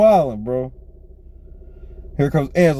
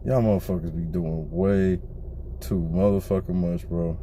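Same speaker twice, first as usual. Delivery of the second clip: much lower and softer, tired exasperation; weary disbelief; 11 semitones lower, 8 dB quieter than usual. Retold or imitated. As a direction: sigh it out like you've given up on them. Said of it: "I was just like".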